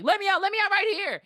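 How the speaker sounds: high, urgent mock pleading; panicked demand. Passenger suspecting the driver is the killer, high panicked demand. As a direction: cry it out high, desperate to get out.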